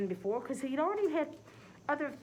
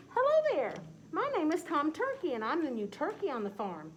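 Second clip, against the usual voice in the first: bright, higher cheerful turkey; eager young turkey, friendly; 6 semitones higher, 4 dB louder than usual. Acted. Tom Turkey introduces himself.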